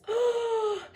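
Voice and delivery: high startled gasp; sharp gasp. Real, not acted. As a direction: gasp in shock. Reader reacts to scene.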